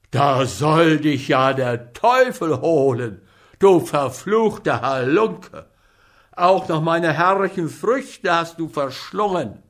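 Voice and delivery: apothecary cursing the glutton; furious cursing. Acted. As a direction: rage at the thief. Apothecary curses Hans.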